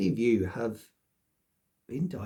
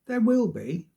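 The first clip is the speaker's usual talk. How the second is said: emphatic; 9 semitones higher, 7 dB louder than usual. Real, not acted. Insisting to self.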